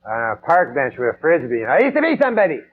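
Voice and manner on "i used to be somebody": a higher, wistful has-been; a faded star reminiscing, lightly voiced; lightly voiced washed-up dog. Washed-up Doberman on park bench.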